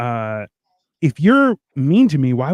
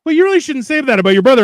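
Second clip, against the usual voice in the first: higher, fast prim reproach; mock prim scolding; 9 semitones higher, 4 dB louder, faster than usual. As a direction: scold like a fussy relative, quickly. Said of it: "we were just like".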